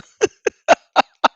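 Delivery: loud breathy laugh at the troll; real laugh. Real, not acted. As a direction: burst out laughing at the threat. Mocking the troll's threat.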